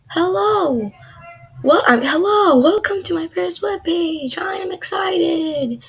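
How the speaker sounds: high, lilting welcome; playful excitement. Acted. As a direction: read it out in a high, showy cheer. Reads own webpage aloud with enthusiasm.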